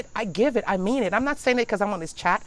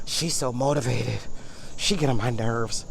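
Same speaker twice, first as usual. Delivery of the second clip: lower, resentful muttering voice; jealous grumble; 9 semitones lower than usual. Retold or imitated. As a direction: mimic a petty envious friend. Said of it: "we just feel like".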